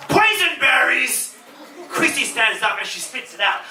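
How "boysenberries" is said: put-on high, slow, alarmed boysenberries; shocked question. Christy horrified at the hidden berry, high and slow. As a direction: recoil at the word.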